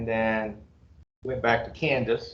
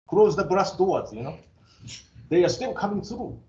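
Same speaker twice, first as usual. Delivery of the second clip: mock alarm; 5 semitones higher than usual. Mock alarm about immigrants.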